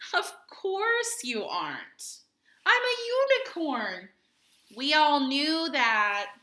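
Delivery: loud, high, slow and sure; happy crowd of narwhals; friends' cheerful reply. Acted. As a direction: reassure him like it's obvious.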